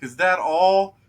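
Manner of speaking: high, loud mock bravado; mock unimpressed. Game master imitating a player who fell a little, loud mock scoffing. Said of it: "and you're like".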